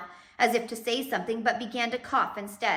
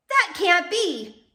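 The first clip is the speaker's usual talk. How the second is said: high, loud and slow disbelief; shocked objection; 9 semitones higher, 8 dB louder, much slower than usual. Acted. Mr Poe shouting disbelief from the audience, loud. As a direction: call out from the crowd.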